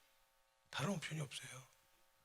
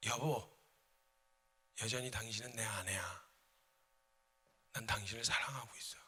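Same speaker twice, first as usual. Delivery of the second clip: low, soft, whispered love; husband softly tells his wife he still loves her; tender confession; 4 semitones lower, 7 dB quieter than usual. Acted. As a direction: say it gently to the wife. Says it a third time.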